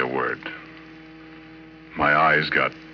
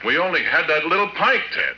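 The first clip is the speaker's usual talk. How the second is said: defensive protest; 12 semitones higher than usual. Acted. Accused by the cop.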